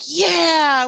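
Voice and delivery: loud, high, drawn-out cheer; long exultant yell that slides down; powered-up cheer. Retold or imitated. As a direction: whoop it out, all pumped up. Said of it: "we were so powered up".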